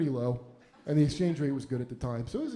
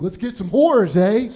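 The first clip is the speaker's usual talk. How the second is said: put-on loud Canadian accent, higher, eh at the end; shouting it out like an eager Canadian; Canadian guy; 5 semitones higher, 12 dB louder, slower than usual. Canadian friend at the phone book, loud keen Canadian accent. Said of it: "my friend was like".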